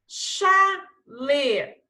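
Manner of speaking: "Chalé" loud, split sha-lé with a long sh; CH sound. Shown as a demonstration. Teacher showing pupils the CH sound, "Chalé" in clear syllables. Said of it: "Som de C H".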